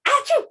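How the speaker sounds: high, loud hammed-up achoo; loud fake sneeze. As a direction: burst out a big pretend sneeze. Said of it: "I've got the sneezies today".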